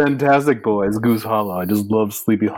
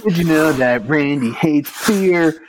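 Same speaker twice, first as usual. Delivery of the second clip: laughing; 6 semitones higher, slower than usual. Acted. Jingle parody, laughing.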